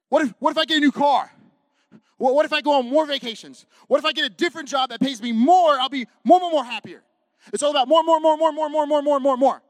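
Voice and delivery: loud, rising chant of wanting; mock greedy want. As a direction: build the greed, hammed-up. Mocking consumer greed.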